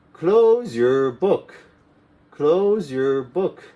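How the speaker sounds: "Close your book" slow with rising close; slow drill. Shown as a demonstration. ESL teacher drilling the class, slowly. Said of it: "slow drilled repetition".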